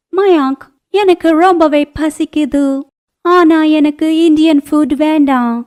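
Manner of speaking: put-on high, sweet young bride voice; a naive girl whining that she's hungry, pitched high; foreign bride. Foreign bride Ana speaks. (வெளிநாட்டு மருமகள், உயர்ந்த இனிய குரலில்)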